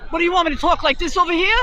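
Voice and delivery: sliding into a cartoon New York accent; asked for a New York accent, she hams it up fast; hammed-up New York accent. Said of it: "can you do a New York accent?".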